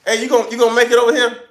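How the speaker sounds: loud, high and fast; calling out. Real, not acted. Asking his friend Newman whether he will come over, a fast loud call. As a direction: shout the question quickly.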